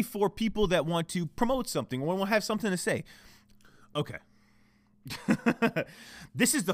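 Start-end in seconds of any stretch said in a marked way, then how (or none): 5.1-5.8 high laugh; exasperated laugh. Real, not acted. Podcaster after his computer crashes, exasperated laugh.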